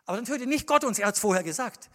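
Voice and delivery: loud, high fiery sermon; preaching at full force. Acted. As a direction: hammer each phrase home to the congregation.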